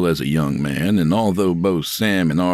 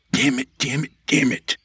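inner curse; 8 semitones higher than usual. Acted. Sam's panicked inner thought.

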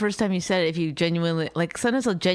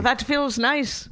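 put-on slow, lightly Irish-accented, higher pitch; 6 semitones higher, much slower than usual. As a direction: drawl it out in a mild Irish accent. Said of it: "I'm doing my Irish accent".

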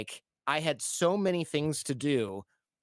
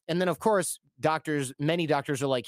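ordinary talk, nothing marked

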